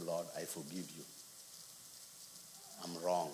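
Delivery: low, soft admission; hushed, retold surrender; humbled prayer. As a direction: confess quietly to God. Retells humbled prayer to God.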